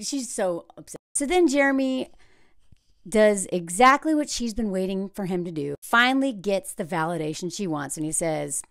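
ordinary talk

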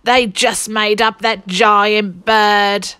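slow, loud grumbling thief; complaining. A narrator's reading. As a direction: whine it like a cheated thief. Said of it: "And Len complained".

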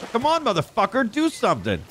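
high-pitched mock-impatient urging; exasperated goading. Player goading an animal to do something, exasperated. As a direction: start very high and egg it on.

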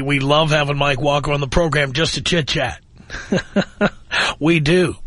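usual speaking voice

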